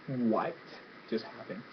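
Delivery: very soft, low, nearly whispered; stunned confusion. Retold or imitated. Viewer reacting to the spirit ripped out, stunned hush. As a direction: barely get it out, dumbfounded.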